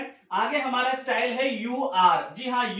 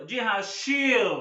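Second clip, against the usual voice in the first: normal talking voice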